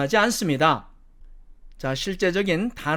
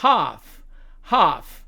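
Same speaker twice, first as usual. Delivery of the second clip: British "half" with long ah; British demo of "half"; British accent. Shown as a demonstration. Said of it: "영국식 발음으로 가면".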